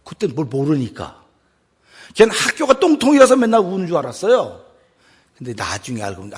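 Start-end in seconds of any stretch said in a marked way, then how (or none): none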